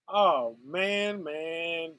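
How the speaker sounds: ordinary talk, nothing marked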